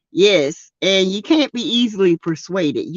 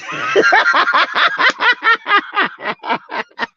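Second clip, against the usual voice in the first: high shrieking laugh; 13 semitones higher than usual. Real, not acted. Bursts out laughing at own joke.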